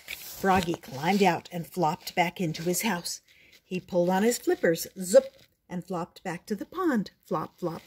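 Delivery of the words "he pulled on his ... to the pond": plain reading voice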